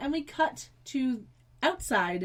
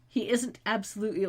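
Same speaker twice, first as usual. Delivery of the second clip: ordinary delivery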